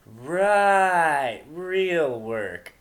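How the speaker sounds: loud, high eye-rolling sarcasm; mock her with an eye roll; sarcastic. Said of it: "roll of her eyes".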